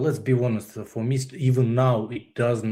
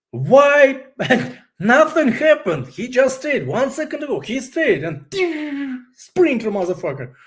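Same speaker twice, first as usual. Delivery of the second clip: much higher, louder, laughing disbelief; exasperated disbelief; 12 semitones higher, 6 dB louder than usual. Real, not acted. Mother recalling her son darting under a car, exasperated laughter.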